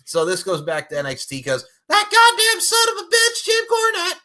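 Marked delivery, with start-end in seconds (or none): 1.9-4.2 very high, loud cursing squawk; exasperated rant. Acted. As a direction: curse his name at the top of your range. Cursing the commentator by name.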